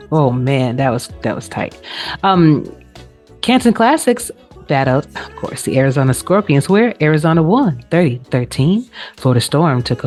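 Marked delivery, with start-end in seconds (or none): none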